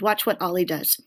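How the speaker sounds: ordinary delivery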